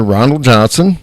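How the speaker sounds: ordinary delivery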